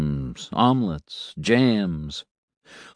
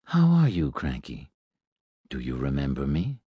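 lower, soft robot priest voice; 7 semitones lower, 5 dB quieter than usual. A narrator's reading. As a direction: speak kindly to the child as the robot. Robot greets the boy.

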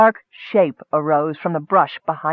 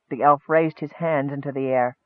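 plain reading voice

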